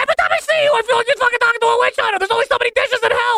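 very high, rapid exasperated rant; yelling. Acted. Fed-up spirit rants at Albie, yelling. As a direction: rant at top pitch without stopping. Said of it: "You can't yell at me".

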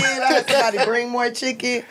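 normal talking voice

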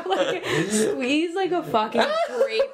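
laughing through the words. Real, not acted. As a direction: giggle while telling it. Laughing while describing video.

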